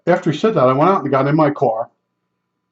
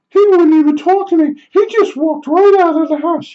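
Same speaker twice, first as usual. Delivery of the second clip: high falsetto whine of a put-out woman; mocking the neighbor; 4 dB louder than usual. Neighbor complaining about the tile guy, high mock falsetto. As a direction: mimic her complaining in a silly squeak.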